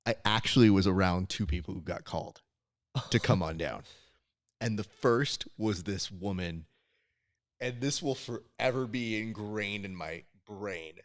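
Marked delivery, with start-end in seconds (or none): none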